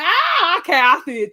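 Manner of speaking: high, loud "ah" of dawning understanding; sudden realization. Retold or imitated. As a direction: re-enact the moment it clicks. Acts out sudden realization on rewatch.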